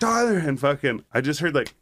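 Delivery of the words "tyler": calling out. Retold or imitated. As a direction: call the brother's name, pitched high. Calling brother in sleep paralysis.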